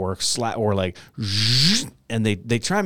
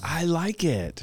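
unconvincing; 6 semitones higher, 5 dB quieter, slower than usual. Real, not acted. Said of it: "You can't even say it convincingly".

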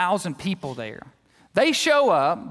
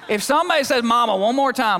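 louder, higher exasperated outburst; mock-furious mom; 6 semitones higher, 5 dB louder than usual. Fed-up mom warns her kids, loud and high. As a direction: let it burst out like a mom at the end of her rope. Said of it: "mumbling under her breath".

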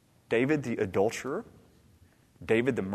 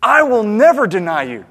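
loud, high declaration of loyalty; vehement vow; 9 semitones higher, 15 dB louder than usual. Acted. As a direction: proclaim it with full force. Voices Peter's vehement vow.

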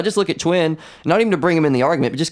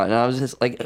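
ordinary talk

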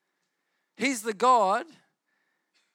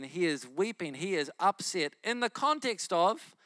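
usual speaking voice

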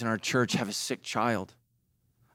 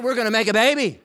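excited announcement; 7 semitones higher, 6 dB louder, faster than usual. Retold or imitated. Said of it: "The ovaries like".

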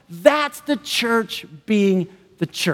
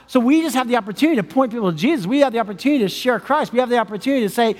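normal talking voice

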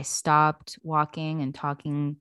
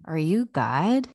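awed question; slower than usual. Acted. Dead man meets God.

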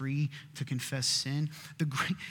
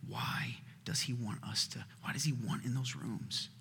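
whisper; 4 semitones lower, 9 dB quieter than usual. Real, not acted. Preacher whispers question.

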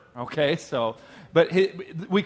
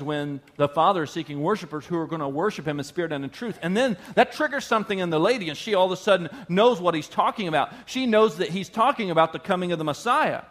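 ordinary talk

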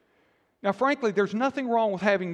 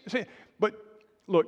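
usual speaking voice

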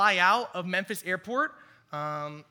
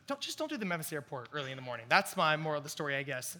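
usual speaking voice

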